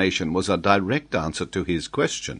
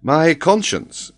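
high, loud, drawn-out exclamation; exclaimed surprise; 10 semitones higher, 8 dB louder, slower than usual. A narrator's reading. Glenarvan is surprised at the declaration, astonished. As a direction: burst out in astonishment. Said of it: "exclaimed Glenarvan".

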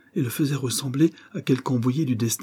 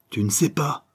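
higher, outraged echo, restrained in volume; roared disbelief; 4 semitones higher than usual. A narrator's reading. Vagabond rages at banker through the bars, outraged. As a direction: throw the denial back in anger. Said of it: "rugit le cheminot".

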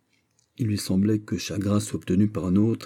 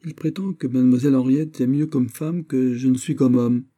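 high-pitched prince; indignant prince; 6 semitones higher, 4 dB louder than usual. A narrator's reading. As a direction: rise up in petulant outrage. Prince voiced in narration. (prince indigné, voix aiguë)

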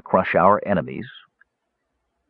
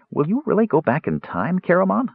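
wistful; 9 semitones higher than usual. Acted. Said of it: "Tass asked wistfully".

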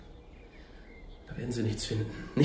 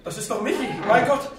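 the horror breaks through as he sees his son; distraught father, high-pitched cries; shocked anguish; 10 semitones higher than usual. Acted. Father discovers son's body.

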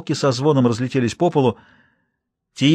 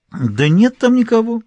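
carefree toss-off; 10 semitones higher, 4 dB louder, slower than usual. A narrator's reading. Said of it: "беззаботно бросила Тия".

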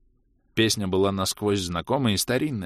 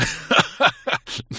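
high breathy burst of laughter; guffaw. A narrator's reading. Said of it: "заржал Влас".

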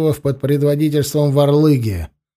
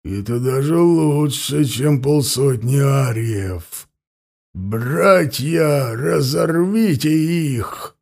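werewolf; 2 semitones lower, much slower than usual. Acted. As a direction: growl it like a wolf lord. Werewolf Sherikh calls his pack.

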